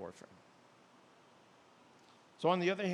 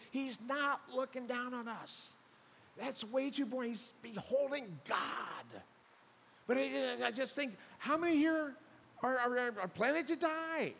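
much higher, louder fervent preaching; impassioned preaching; 13 semitones higher, 4 dB louder than usual. Real, not acted. Preacher rousing the congregation about death, fervent and loud. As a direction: preach it out at the top of the voice.

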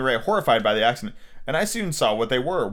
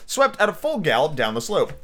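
ordinary delivery

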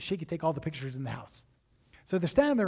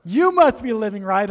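louder, higher repeat of the line; emphatic repeat; 4 semitones higher, 11 dB louder than usual. Retold or imitated. Preacher repeating the realtor's words louder for emphasis. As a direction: punch the repeat out loud.

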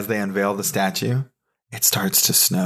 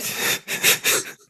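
silent wheezing laugh; breathy laugh. Real, not acted. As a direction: laugh without voice, just air. Caught off guard by twist.

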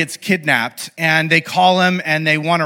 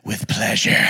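savoring relish; 9 semitones lower, 5 dB quieter than usual. Retold or imitated. Monte Cristo relishing his revenge.

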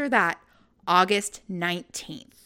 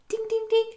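sing-song ding ding ding, higher; mock ding sound; 12 semitones higher than usual. Imitating the quiz show answer chime.

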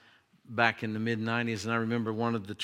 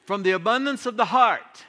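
higher, louder declaiming of scripture; preaching; 8 semitones higher, 6 dB louder than usual. Acted. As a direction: proclaim it to the congregation.

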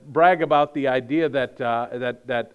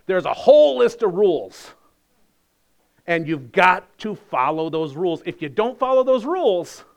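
mock stern rule-giver; 3 semitones higher, 5 dB louder than usual. Preacher as legalist insisting on rules, loud and stern. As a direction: hammer the rules home.

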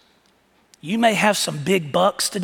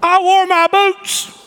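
put-on high, slow and proud like a small boy; little boy voice; 13 semitones higher, 8 dB louder than usual. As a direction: show off your boots like a proud kid. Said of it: "Britton came in and says".

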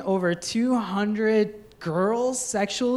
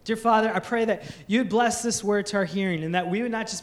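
prayer; 4 semitones lower than usual. Acted. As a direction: pray over the congregation gently. Pastor prays aloud.

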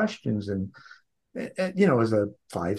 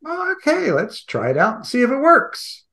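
put-on high-pitched, excited little kid voice; eager child self; 13 semitones higher, 4 dB louder than usual. Child decides to try talking to heaven, high and eager.

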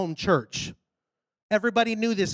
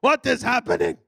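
baffled, imitated African preacher; African pastor; 3 semitones higher, 4 dB louder than usual. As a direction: demand answers in an African accent. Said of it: "Big old African guy".

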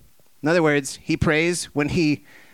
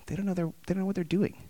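soft mock God voice; 5 semitones higher, 7 dB quieter, much faster than usual. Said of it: "God's like".

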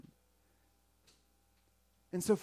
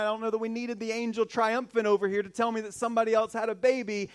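ordinary talk

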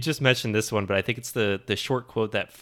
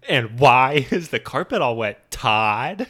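mock yell; 4 semitones higher, 8 dB louder, slower than usual. Quoting Margo.

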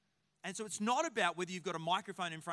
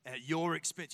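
low, sly relish; mischievous; 6 semitones lower than usual. Retold or imitated. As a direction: lower the voice to scheme some fun. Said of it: "I'm like".

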